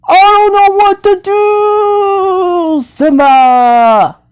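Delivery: high, loud, drawn-out mock despair; hammed-up wail. Calling out to Simba in helpless distress, hammed-up wail. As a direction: wail it slowly, stretching the last words. Puppet figure cries out to Simba.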